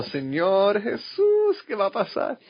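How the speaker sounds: a man recalls praying in tears at a church meal; tearful plea. Retold or imitated. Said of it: "y llorando".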